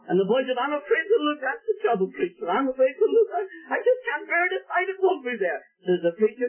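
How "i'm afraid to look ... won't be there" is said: high, crying plea; a scared lad who cannot bear to look; fearful boy. Acted. Prodigal telling the preacher he dare not look, trembling and tearful. Frightened boy voiced in story.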